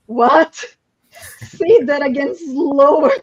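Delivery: high, loud amazed reaction with a laugh; stunned disbelief. Retold or imitated. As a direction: act out delighted shock, breaking into laughter. Retelling the team's stunned reaction.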